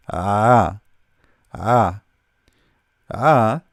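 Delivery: A as a drawn-out Arabic ain from the throat; pronunciation demo. Shown as a demonstration. Teacher shows learners a difficult letter to pronounce, pronunciation demo. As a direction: sound out A slowly, each one on its own. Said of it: "do your best to pronounce it".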